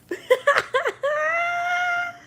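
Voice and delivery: high shrieking burst of laughter. Real, not acted. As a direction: cackle till it cracks into a cry. Cracks up at own joke.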